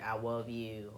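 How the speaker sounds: "love" said as "wove". Retold or imitated. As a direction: imitate a child turning "love" into "wove". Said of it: "like, W".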